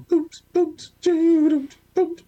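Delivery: high-pitched mock sound effects; imitated booms. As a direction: punch out booms for quick wrestling moves. Imitating fast-forward vignette moves.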